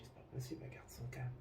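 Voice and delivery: whispered disbelief under the breath; hushed, astonished aside; awed whisper. Retold or imitated. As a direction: breathe it out, amazed. Amazed she stays calm.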